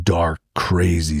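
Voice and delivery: normal talking voice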